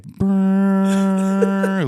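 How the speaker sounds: high rolled brrrr; imitated music sting. Friend describing a clip's music to friends, imitated sting. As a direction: trill a long, high music cue.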